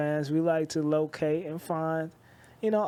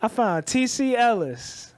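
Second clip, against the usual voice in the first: higher, eager show-off announcement; proud offering; 7 semitones higher, 4 dB louder, slower than usual. Retold or imitated. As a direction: present the gift with pride. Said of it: "I'm like".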